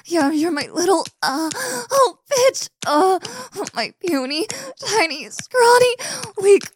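high, loud breaking cries building to a peak; climax cries. Acted. Taunting her partner as she climaxes, high breaking cries.

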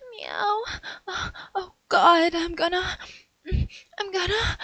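high, mewling, building gasps toward climax; climbing moans. Acted. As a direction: let the meows rise out of control. Nearing climax, moaning.

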